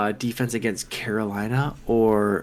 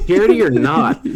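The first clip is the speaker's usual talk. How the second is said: laughing; 7 dB louder than usual. Real, not acted. Laughing, outburst.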